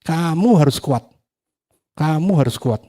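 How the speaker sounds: mild mock scolding, insistently repeated; retold stern rebuke. Preacher retells scolding his mirror reflection, mildly stern. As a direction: re-enact snapping firmly at yourself. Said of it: "Saya bentak dia".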